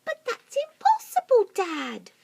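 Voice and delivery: very high, loud whiny objection; small monkey protesting to his father; protesting little monkey. Acted. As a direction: protest to dad.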